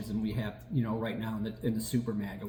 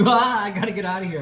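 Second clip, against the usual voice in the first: exasperated sane person; 6 semitones higher, 7 dB louder than usual. Retold or imitated.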